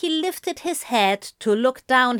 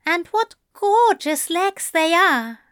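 high, admiring angel voice; playful, high-voiced compliment; smiling; 8 semitones higher, 4 dB louder than usual. A narrator's reading. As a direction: pitch it up and flirt through a smile. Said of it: "Aziraphale smiled".

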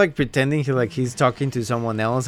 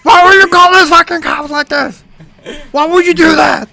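much higher and louder outraged yell; excessive tone; 13 semitones higher, 10 dB louder than usual. Retold or imitated. Friend imitates prank caller yelling down the phone. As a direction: blow up like a furious husband. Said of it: "with an excessive tone".

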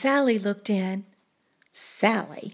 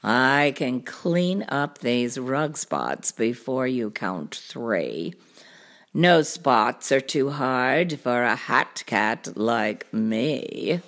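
low, slow cartoon cat voice; deep, leisurely show-off cat; boastful cat; 10 semitones lower, slower than usual. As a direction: brag slowly, deep and easy.

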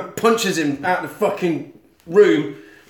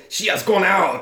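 put-on French accent; 4 semitones higher than usual. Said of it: "he's a very French man".

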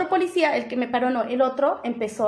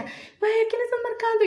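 nervous friend; 10 semitones higher, 4 dB quieter than usual. Retold or imitated. Friend asks who she is calling, mock nervous. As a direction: imitate a panicky friend questioning a risky call. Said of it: "mi amiga también se puso súper nerviosa".

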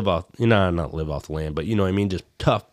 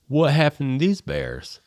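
higher-pitched, mock-concerned question; alarmed stranger; 8 semitones higher than usual. Passerby asks hunters about the bears, mock alarm. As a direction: imitate a bystander taken aback.

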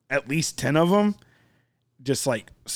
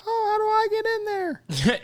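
much higher, put-on voice of a keen kid; eager kid voice. Made-up kid in class asking about prison, high eager voice. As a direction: play a kid dying to get in.

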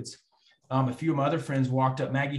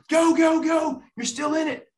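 much higher, loud yelling of go, go; friends shouting encouragement; 6 dB louder, slower than usual. Retold or imitated. Friends on the sideline yell at him, shouting. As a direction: cheer him on hard from the sideline.

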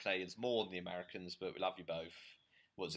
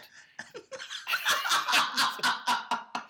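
loud high laugh; 7 dB louder than usual. Real, not acted. Host laughs at his own jab about proud Scots, a loud high burst.

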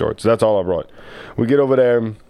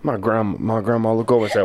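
ordinary talk

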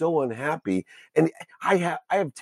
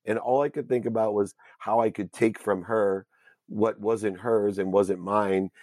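ordinary talk, nothing marked

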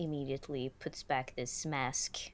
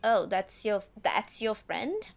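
put-on high, loud German-Swedish-accented reaction; broken German-Swedish-English accent; 5 semitones higher, 7 dB louder than usual.

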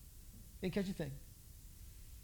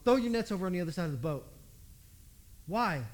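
ordinary delivery

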